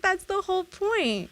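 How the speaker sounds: high, teasing gotcha; smug retort. Retold or imitated. Teasing her brother after the prank, high and smug. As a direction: tease him, you won fair and square. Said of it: "I'm like".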